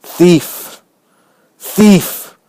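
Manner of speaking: from a low charge to a loud cry; building dreadful accusation. A narrator's reading. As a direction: hammer the word thief, growing each time. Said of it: "In a low but dreadful voice".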